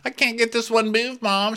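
put-on high pleading girl voice; whiny daughter. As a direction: whine to mom about the move. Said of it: "daughter whining to mom".

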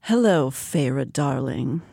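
low, slow seductive purr; seductive demon purr. Acted. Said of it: "he purred".

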